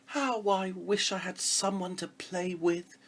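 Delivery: high, slow, wistful princess sigh; sighing wish. Acted. Lonely princess wishing for a playmate, sighing. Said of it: "she sighed".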